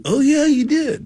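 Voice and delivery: very high-pitched retold afterthought; high, put-on 'oh, right' voice; oblivious realisation. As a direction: imitate yourself remembering far too late.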